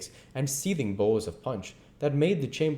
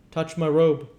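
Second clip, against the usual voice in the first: high, loud hearty command; commanding ghost; 9 semitones higher, 8 dB louder than usual. Acted. Ghost tells Scrooge to look at him, high and loud. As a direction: bid him look, grandly.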